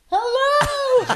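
high, drawn-out singsong greeting; imitated hello. Re-enacting a posed moment for cohosts, high singsong hello. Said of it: "you were going like this".

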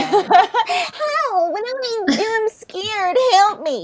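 high whiny helpless voice; lightly voiced scared son; mock helpless son. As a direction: play the helpless grown man. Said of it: "mimicking helpless son".

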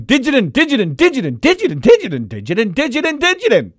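high, insistent chant of nonsense words; imitating emphatic sleep talk. Husband mimics wife's sleep talk to her, high and insistent. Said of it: "You started going".

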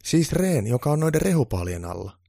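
high, loud elf voice; eager high-pitched little helper; elf girl explaining. A narrator's reading. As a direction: pipe up to clarify. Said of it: "Saara tarkensi".

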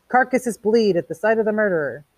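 mock scream. A narrator's reading. Beatty imitating Montag's outburst, mock screaming. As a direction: declaim it as a tame retold scream. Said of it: "And you screamed".

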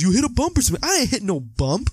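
high, lightly acted excuse and retort; mock back-and-forth. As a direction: toss the excuse off and snap it back. Back-and-forth film dialogue acted out.

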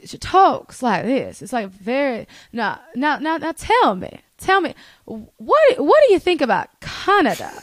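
stretched-out, stately Southern accent; regal Southern grandmother. Shown as a demonstration. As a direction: imitate an old-fashioned regal Southern lady. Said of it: "she has the most beautiful Southern accent. It's regal".

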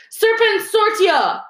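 high, slow, loud spell cry; bellowed spell. A narrator's reading. Malfoy bellows his spell at Harry, high and slow.

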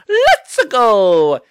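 quick mock Mario shout; Mario catchphrase. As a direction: belt it out like the game plumber. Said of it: "annoying hearing".

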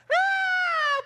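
very high, loud battle scream; Braveheart war cry. Retold or imitated. Army charging into battle, high loud war cry.

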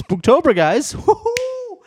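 high, loud Spooktober hype ending in a whoop; bright festive announcement to viewers; excited cheer. Real, not acted. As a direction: whoop it up for Halloween month. Announcing Halloween month.

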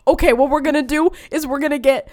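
loud, eager bakery boss pitch; mock enthusiastic baker. Imitating the boss pitching a cake to a parent, loud mock enthusiasm. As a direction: sell the idea like a TV baker. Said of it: "And he's like".

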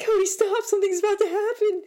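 high, loud urgent warning to a friend; urging the friend at the blackout, high and loud; urgent excited call. Retold or imitated. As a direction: stop him, something's coming.

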